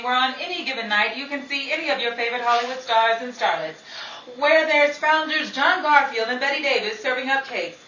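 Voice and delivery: bright, loud vintage radio announcer; old-time radio host. Acted. Host welcomes the radio audience, brassy vintage announcer. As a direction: sell the canteen like a 1940s emcee.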